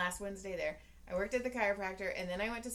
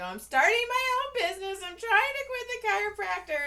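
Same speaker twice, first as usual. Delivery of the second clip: high, loud outburst; verbal vomit; 13 semitones higher, 8 dB louder, faster than usual. Acted. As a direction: blurt it all out at once. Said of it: "I vomited on you verbally".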